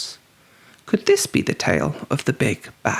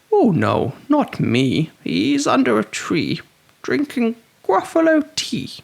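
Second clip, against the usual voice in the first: higher sly creature voice; higher teasing voice; 8 semitones higher than usual. A narrator's reading. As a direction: tease the child. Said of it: "creature answers child".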